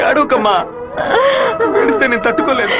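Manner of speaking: high, pained plea; distressed pleading. Acted. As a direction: beg a sobbing mother to stop. Son begs sobbing mother not to cry.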